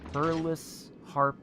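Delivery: drawn-out "Perlus", then a distinct "Harp"; pronounced as spelled. Shown as a demonstration. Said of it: "pronounced exactly how it's spelled".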